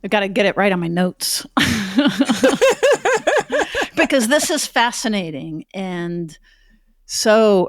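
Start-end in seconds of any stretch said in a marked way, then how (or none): 1.5-3.9 high chuckling laugh. Real, not acted. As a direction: laugh lightly at yourself, pitched high. Laughs after joking about notes.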